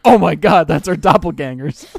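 loud, astonished mock exclamation; retold amazement. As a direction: blurt it out, re-enacting the shock. Retold reaction to seeing lookalikes.

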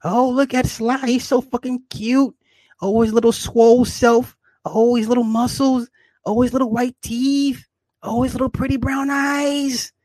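high cooing gushing over Sly; high cute voice admiring his own muscles and eyes; cutesy cooing. Pretend. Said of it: "I'm all cute and cuddly and furry".

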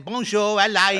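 high, loud hammed-up French accent; mock French greeting. Copying his friend's French hello, in a loud, high French accent. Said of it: "even the way you said it to me then".

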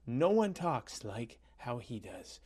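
usual speaking voice

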